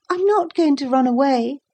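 put-on high-pitched small boy's voice; protesting boy. A narrator's reading. Boy to the guard holding him, protesting.

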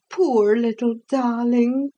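a hint of the pitying cook. A narrator's reading. Cook voiced in story.

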